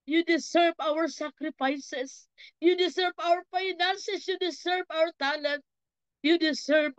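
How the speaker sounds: pleading prayer, rising in pitch as it builds; fervent prayer. Real, not acted. Preacher prays for everyone in the congregation, fervent pleading. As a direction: plead with God, stretching the key phrases.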